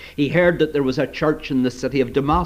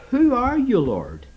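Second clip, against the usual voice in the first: startled question; 8 semitones higher than usual. Acted. Startled Saul asks.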